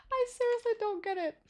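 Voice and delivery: very high, soft squeak of exasperation; helpless bafflement. Real, not acted. Streamer baffled by the teammate's work, helplessly exasperated. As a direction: squeak it quietly, at a loss.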